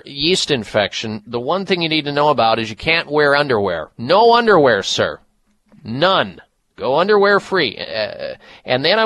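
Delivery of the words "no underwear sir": usual speaking voice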